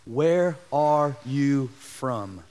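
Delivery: very slow and low, word by word; slow, grave questioning. Acted. Preacher as Pilate questioning Jesus, slow and grave. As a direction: space out each word, pitched low.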